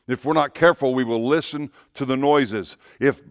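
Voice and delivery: normal talking voice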